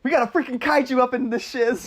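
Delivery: high excited quote of the office guy; mimicking the guy's frantic line; mock movie line. Friends quoting the office guy, excited mock shout.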